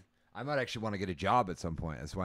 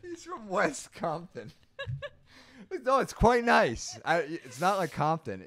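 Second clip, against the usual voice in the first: laughing; 12 semitones higher, 7 dB louder, slower than usual. Acted. Joking high laughing voice.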